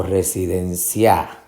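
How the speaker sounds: residencia ending on stressed CIA; misplaced final stress. Shown as a demonstration. Teacher testing options, residen-CIA. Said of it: "tratar de identificar".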